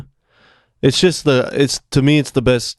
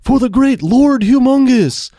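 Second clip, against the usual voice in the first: high, drawn-out acclaiming cry; imitating a lookout hailing his leader; distant herald's call; 11 semitones higher than usual. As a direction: call it out slowly like a far-off lookout. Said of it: "you hear them off camera in the distance".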